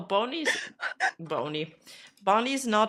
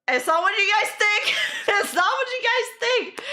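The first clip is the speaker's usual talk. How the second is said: much higher, pleading protest to chat; rising panicked protest; flustered denial; 12 semitones higher, 3 dB louder than usual. Real, not acted. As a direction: squeak out a flustered defence.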